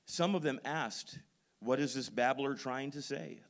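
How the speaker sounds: loud, ringing pulpit delivery; full-force preaching. Acted. Pastor fires up the congregation on Paul, preaching loud. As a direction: preach it out to the whole room.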